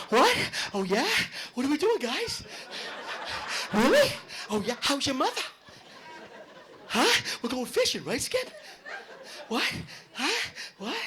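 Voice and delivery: a jacked-up guy who never knows what's going on; Mark Wahlberg. Retold or imitated. Boston movie star lost on set, high confused yelps. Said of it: "Mark Wahlberg".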